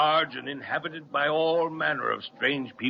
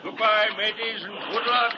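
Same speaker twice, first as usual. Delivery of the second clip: calling out; 8 semitones higher than usual. Acted. Captain calls as boat leaves.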